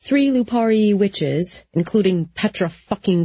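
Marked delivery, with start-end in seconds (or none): none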